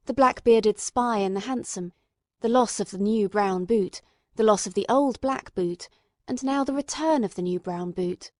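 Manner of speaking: ordinary delivery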